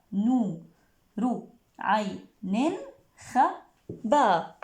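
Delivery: slow, chopped syllables. Shown as a demonstration. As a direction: tap out each syllable. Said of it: "يلا التفعيلات".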